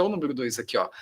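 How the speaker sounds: ordinary talk, nothing marked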